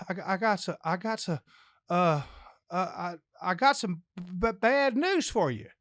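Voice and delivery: halting stammer with filled pauses; stammered confession, rising at the end; stuttering. Acted. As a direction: get stuck on the words, then push through. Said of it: "uh, stuttered real".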